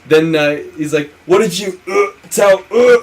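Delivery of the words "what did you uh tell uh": words broken by rising, loud mock grunts; hammed-up convulsive gulps breaking the question; seizing mid-sentence. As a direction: imitate choking between words. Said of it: "And then he starts seizing".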